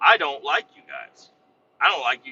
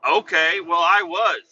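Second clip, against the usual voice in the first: louder, higher sarcastic comeback; sarcastic retort; 5 semitones higher, 6 dB louder, slower than usual. Retold or imitated. Answering the coworker who wasn't hungry, loud sarcastic. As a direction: throw it back at him.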